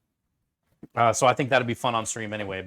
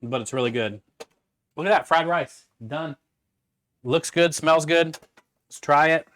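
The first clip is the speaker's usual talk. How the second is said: ordinary talk, nothing marked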